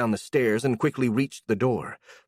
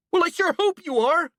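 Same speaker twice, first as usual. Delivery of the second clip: much higher, shrill with anger; voice rising high; 3 dB louder than usual. Acted. A furious girl answering the apology with a high voice. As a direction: let the voice shoot up. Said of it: "Her voice rose by many octaves".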